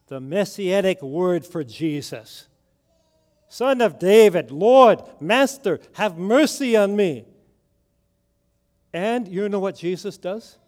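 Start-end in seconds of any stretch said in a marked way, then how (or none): 3.5-7.3 louder, pleading cry to the Lord; crying out for mercy. Retold or imitated. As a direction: beg him loudly, rising desperation. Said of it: "she is howling and whining and crying out".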